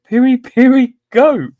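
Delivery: can't believe what chat wrote; a real laugh breaking through; incredulous laugh. Real, not acted. Rereads the answer, cracking up.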